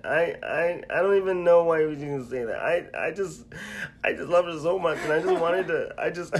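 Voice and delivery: high, loud, hammed-up comedian impression; Kevin Hart. Friend performing his reply for the audience, Kevin Hart impression. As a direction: wail in exaggerated comic disbelief. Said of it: "Do it in your Kevin Hart voice".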